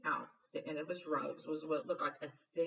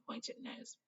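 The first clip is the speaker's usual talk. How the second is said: plain reading voice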